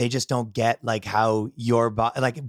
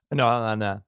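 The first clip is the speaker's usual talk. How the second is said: ordinary talk, nothing marked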